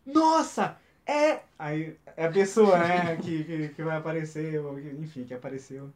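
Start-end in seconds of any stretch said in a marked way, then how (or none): 0.0-1.4 high, loud surprised yelp with a laugh; loud, high laughing cry of surprise; excited shout. Retold or imitated. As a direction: shriek it out like a fan who just spotted a hero. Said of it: "Aí teve uma pessoa que gritou".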